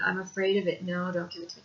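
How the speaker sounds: ordinary delivery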